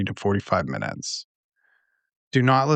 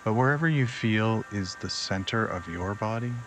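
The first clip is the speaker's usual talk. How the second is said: unhurried, lower and quieter, easing them into relaxation; guided meditation; 3 semitones lower, 7 dB quieter than usual. Acted. Host guides listeners to relax before sleep, guided meditation.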